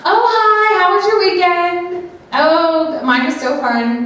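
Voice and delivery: bury hurt under a fake-cheerful greeting; fake nice. Shown as a demonstration. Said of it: "Instead of being like".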